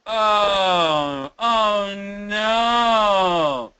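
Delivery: high, loud, drawn-out "oh no"; moaned words. Shown as a demonstration. Teacher demonstrating speech connection, moaning loudly. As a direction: moan it out long, falling at the end. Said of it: "Moan, moan from wherever you are".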